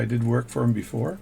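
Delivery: ordinary talk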